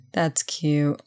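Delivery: usual speaking voice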